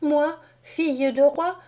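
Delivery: high, plaintive doll voice; a proud little doll wailing at her fate; doll's lament. A narrator's reading. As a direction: princess doll crying out in despair. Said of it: "disait mademoiselle Rose".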